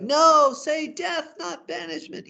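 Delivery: very high cry, loud on the first word, then falling away in volume; hammed-up anguished plea; acted distraught. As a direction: wail in theatrical despair. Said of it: "He's distraught".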